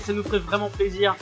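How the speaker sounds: normal talking voice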